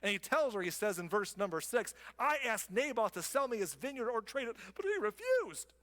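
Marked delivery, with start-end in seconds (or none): 2.2-5.7 higher, whining complaint rising into a squeal; whiny Ahab. Acted. Sulking king complains to wife in a whine. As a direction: whine it like a spoiled child. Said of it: "Ahab told her".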